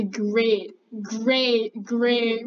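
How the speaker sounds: normal talking voice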